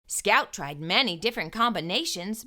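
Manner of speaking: ordinary delivery, nothing marked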